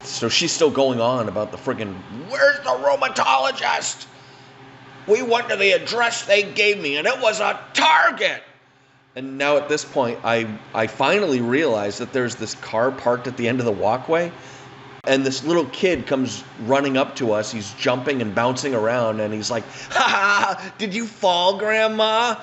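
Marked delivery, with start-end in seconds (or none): 2.3-8.4 put-on old lady voice. 19.9-22.4 put-on high teasing child voice; mock her like a giggly little brat, pitched up; bratty kid. Little kid mocking grandma.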